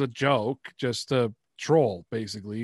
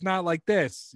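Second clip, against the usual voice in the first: higher-pitched put-on Boston accent; 6 semitones higher, 3 dB louder, much slower than usual. Shown as a demonstration. YouTuber showing the voice he dropped, Boston accent. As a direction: flip briefly into the Boston character. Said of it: "why don't you drop the shitty Boston accent".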